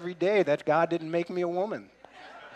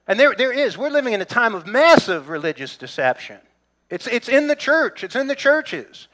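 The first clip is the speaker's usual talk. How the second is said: preaching emphasis; 6 semitones higher, 6 dB louder than usual. Real, not acted. Preaching about religious deception.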